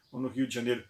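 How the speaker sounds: usual speaking voice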